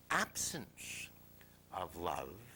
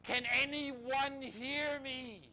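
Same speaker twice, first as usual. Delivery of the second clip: higher, louder, long-stretched call; crying out louder; 10 semitones higher, 5 dB louder, much slower than usual. Retold or imitated. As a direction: repeat the acted call for help with more force. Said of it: "and then he cries".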